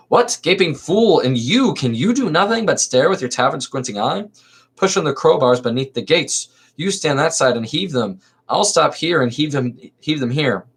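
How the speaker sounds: lively theatrical reading of a verse comedy; dramatic play reading. Acted. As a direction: read the chorus lines with stage energy. Magistrate ordering archers.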